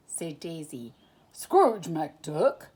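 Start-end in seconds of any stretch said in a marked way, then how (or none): 1.3-2.7 surprised cry. A narrator's reading. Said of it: "cried Goofy".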